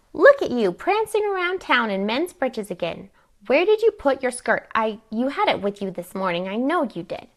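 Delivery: high, loud bursts of disapproval; fussing, scolding. Acted. Aunt berates niece over men's breeches, scolding. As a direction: nag her, hitting each new complaint high.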